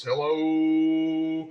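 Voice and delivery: long drawn-out hello and audio calls; playful stretched mic test; radio mic check. Shown as a demonstration. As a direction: belt out test calls to watch the meter swing. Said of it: "let's see how it does P E P here".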